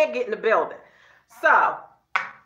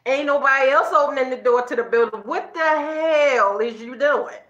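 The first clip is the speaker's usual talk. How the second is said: usual speaking voice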